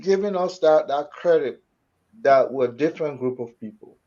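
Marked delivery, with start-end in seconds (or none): none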